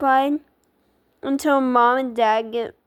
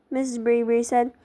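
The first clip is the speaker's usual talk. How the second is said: plain reading voice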